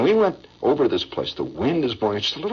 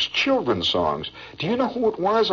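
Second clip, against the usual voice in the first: ordinary talk, nothing marked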